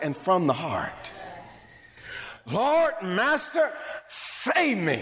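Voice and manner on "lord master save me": high, drawn-out desperate plea; acted crying out. Peter sinking, crying out to his master, high pleading cry. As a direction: cry out for rescue, stretching each word. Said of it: "he cried out".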